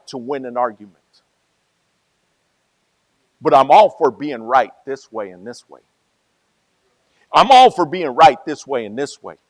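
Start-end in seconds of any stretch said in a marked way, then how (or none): 3.4-5.8 preaching louder. Real, not acted. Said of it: "preacher repeats the line". 7.3-9.3 preaching louder. Real, not acted. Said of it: "preacher repeats the line".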